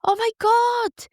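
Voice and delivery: overjoyed to see her nan again; joyful disbelief. Retold or imitated. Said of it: "And I went".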